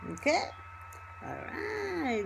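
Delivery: ordinary talk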